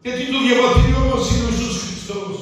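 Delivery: a preacher drives his point home to the church, forceful preaching. Real, not acted. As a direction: preach it to the congregation. Preacher builds to full force.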